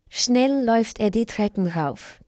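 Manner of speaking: usual speaking voice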